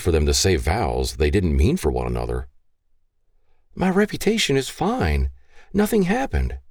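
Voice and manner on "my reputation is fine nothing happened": put-on high, pleading young woman's voice; pleading daughter. Daughter begging her gun-waving father, high and pleading. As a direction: beg your father to spare him.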